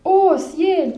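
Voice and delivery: high, loud startled cry; a snake suddenly seeing the monster; alarmed exclamation. A narrator's reading. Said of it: "s'exclama-t-il".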